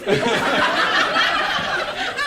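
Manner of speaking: real high burst of laughter. Real, not acted. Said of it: "it was really funny".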